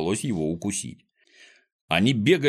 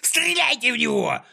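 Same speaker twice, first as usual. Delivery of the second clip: high, furious scream to fire; spluttering yell; 14 semitones higher than usual. A narrator's reading. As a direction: shriek the order, spraying spit. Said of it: "орал, брызгая слюной".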